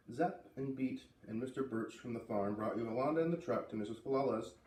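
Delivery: plain reading voice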